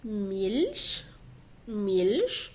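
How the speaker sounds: slow ميلش ending in "sh"; low, stretched ميلش as "meelsh"; CH as sheen. Shown as a demonstration. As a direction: draw out ميلش, soft hiss at the end. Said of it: "بتقرا شين".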